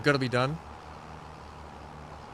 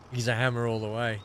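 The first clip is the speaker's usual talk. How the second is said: ordinary delivery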